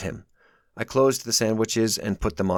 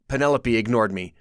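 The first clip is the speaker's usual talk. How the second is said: usual speaking voice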